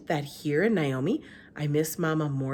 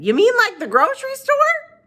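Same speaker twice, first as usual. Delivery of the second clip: put-on high, far-off old woman voice; Gloria Dump voice; 10 dB louder than usual. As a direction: pitch her up as an old lady.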